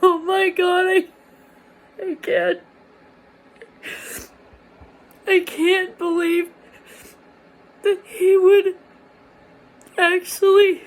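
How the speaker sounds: crying. Real, not acted. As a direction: sob the words out in disbelief. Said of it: "I was sitting there crying like".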